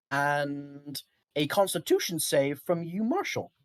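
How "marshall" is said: ordinary delivery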